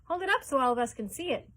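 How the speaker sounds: loud, high crowd call; shouting. A narrator's reading. A crowd member shouts to hold the ticket up. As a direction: demand to see it, loudly.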